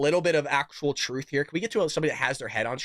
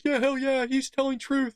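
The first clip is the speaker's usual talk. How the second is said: mocking cheering crowd; 10 semitones higher than usual. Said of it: "And people are like".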